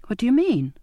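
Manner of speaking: mildly frightened, pitch lifted. A narrator's reading. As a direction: ask with rising alarm. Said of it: "I said, frightened anew".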